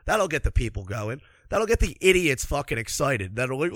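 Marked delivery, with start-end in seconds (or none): none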